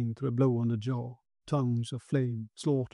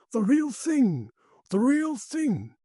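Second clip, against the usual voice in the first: high, drawn-out amazed cry; awed exclamation; 11 semitones higher, slower than usual. A narrator's reading. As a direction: stretch it out, pitched high, in awe. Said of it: "breathed Quinn".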